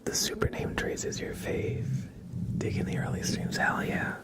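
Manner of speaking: unhurried breathy ASMR murmur; ASMR whisper. Acted. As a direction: whisper gently to the chat like ASMR. ASMR stream intro whispered.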